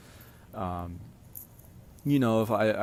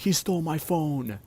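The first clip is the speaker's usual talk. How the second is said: put-on whiny teen excuse; 7 semitones higher than usual. Teen's defensive excuse.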